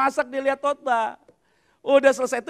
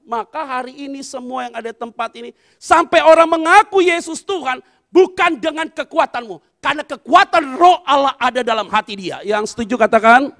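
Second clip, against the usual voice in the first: loud, rising pulpit call; rally the church to shout agreement; preaching; 9 dB louder than usual. Acted. Pastor rallying congregation.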